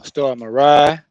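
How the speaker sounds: loud, drawn-out "ride" for rice. Shown as a demonstration. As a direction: say "ride" with the end of rice dropped, stretched. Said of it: "Ride is rice".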